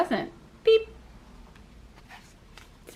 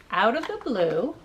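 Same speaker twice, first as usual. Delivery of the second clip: plain reading voice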